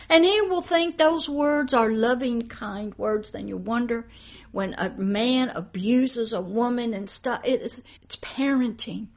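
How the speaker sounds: ordinary delivery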